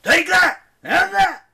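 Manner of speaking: very loud, high battle shouts; yelling. Acted. Coach Hedge bashing the shell, loud high yells. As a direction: whack and holler each line.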